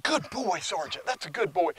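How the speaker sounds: high, affectionate praise for the dog; loving reward voice. Shown as a demonstration. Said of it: "giving a good loving reward".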